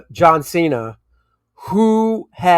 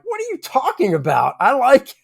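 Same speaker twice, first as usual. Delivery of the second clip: high, incredulous pushback; defending the accent to his daughter, mock outrage; mock indignant; 9 semitones higher than usual. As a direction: act baffled she could dislike it. Retorting to daughter's accent complaint.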